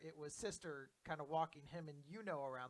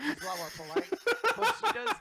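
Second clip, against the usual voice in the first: laughing; 16 dB louder, faster than usual. Real, not acted. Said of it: "laughing aside".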